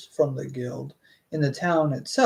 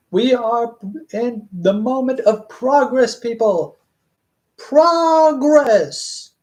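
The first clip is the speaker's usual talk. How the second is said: high, hammed-up rallying cry; mock proclamation; 6 semitones higher, 5 dB louder, slower than usual. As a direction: proclaim it grandly, louder and higher to the end. Mock proclamation after stumbling on word.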